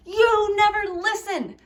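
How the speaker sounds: high, whiny complaint; high petulant accusation; accusing whine. Shown as a demonstration. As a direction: snap the blame at them in a whine. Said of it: "Isn't that much better than".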